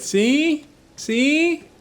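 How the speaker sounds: gloat, high and loud; triumphant. Real, not acted. Proving to friend the accent is good, gloating.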